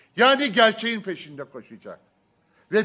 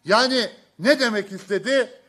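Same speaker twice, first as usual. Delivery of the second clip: ordinary talk, nothing marked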